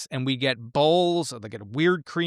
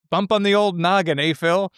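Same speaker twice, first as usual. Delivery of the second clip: a hint of Albert's dry quip, a little higher and louder; imitating Albert; 6 semitones higher, 6 dB louder than usual. Podcast host quoting Albert's line to Phil, lightly voiced. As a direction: toss it off like Albert teasing. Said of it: "such a wonderful Albert line".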